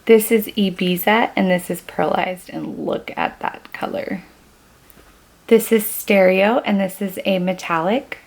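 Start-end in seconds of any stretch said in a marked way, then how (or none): 2.5-4.2 softer, whispered marvel; whispering to viewers about a colour; awed whisper. Real, not acted. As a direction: lean in and whisper it. Admiring swatch.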